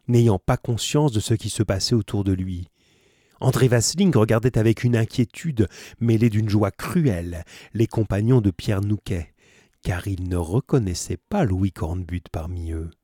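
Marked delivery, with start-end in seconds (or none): none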